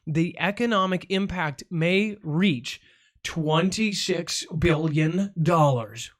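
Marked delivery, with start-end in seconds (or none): 3.2-6.1 slowed way down for effect; stretched out for drama; dramatic slow-down. Acted. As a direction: drag out the big number. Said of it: "I add an effect to that".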